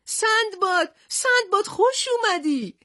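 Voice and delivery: high, loud joyful call across the beach; joyful shout. A narrator's reading. Old fisherman calling out to Sindbad, a joyful shout. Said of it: "به طرف جوان دوید و فریاد زد".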